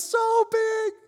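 put-on very high, loud child squeal; excited toddler. Toddler son answering his parents' game, excited high squeal. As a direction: shout it proudly in a tiny kid's voice. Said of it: "He goes".

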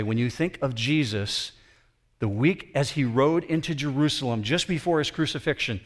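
ordinary delivery

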